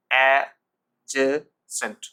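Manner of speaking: slow, loud a... je... sent for adjacent; three separated beats in adjacent, d silent; syllable-by-syllable model. Shown as a demonstration. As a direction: stretch adjacent for pupils to copy. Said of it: "উচ্চারণ করুন আমার সাথে".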